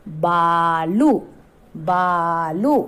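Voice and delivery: very slow, बालू with the ऊ stretched long; long drawn ऊ. Shown as a demonstration. Teacher showing the long vowel to children, slow. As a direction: draw out the ऊ in बालू. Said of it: "बड़े ऊ की मात्रा".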